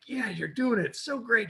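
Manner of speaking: excited inner voice; self-congratulating ego. Retold or imitated. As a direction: cheer yourself on like a proud ego. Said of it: "part of my ego's like".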